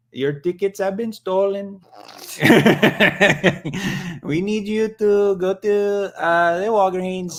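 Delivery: high-pitched mock Indian call-centre scammer; Indian scammer accent. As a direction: put on a loud, pushy Indian phone-scam accent. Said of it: "do tech support Indian scammer".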